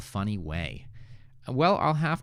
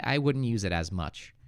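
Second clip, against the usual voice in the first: ordinary talk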